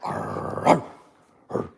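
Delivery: quiet breathy growl at the dog; a hint of a playful growl; soft dog growl. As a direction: growl softly to rile him up. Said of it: "Watch, he'll get all".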